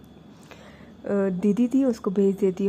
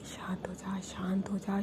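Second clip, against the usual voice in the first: hushed soothing whisper; 9 dB quieter than usual. Retold or imitated. Older sister whispers to calm her crying sister.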